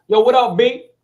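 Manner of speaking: a friendly Harlem yo; Harlem street greeting. Retold or imitated. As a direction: call out to your boy the way you grew up. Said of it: "we used to always say".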